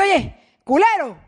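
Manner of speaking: playful insult shouted at the dog; calling the dog. Retold or imitated. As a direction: call the dog out loud and slowly. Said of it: "le dices".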